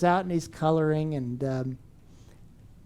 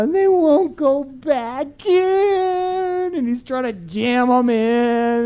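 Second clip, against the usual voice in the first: put-on whiny child; 6 semitones higher, slower than usual. Child's meltdown voiced in story.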